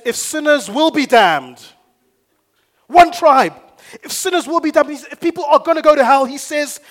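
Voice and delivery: high, shouted sermon climax; full-force preaching. Real, not acted. Preacher quoting Spurgeon to rouse the church, at full force. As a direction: cry it out to the whole congregation at full force.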